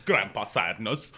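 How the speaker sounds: slow, bad Dutch accent; a hammed-up Dutchman. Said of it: "worst Dutch accent ever".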